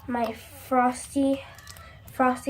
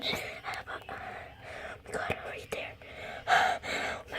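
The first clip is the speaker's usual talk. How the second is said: a much softer, slow, breathy whisper; whispered dismay; 12 dB quieter, much slower than usual. Real, not acted. Girl leaning over the toilet at the slime, soft whispered dismay. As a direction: barely breathe the words out.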